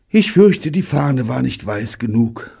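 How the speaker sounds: high onset easing down like a sigh; acted sighing regret. A narrator's reading. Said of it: "seufzte David".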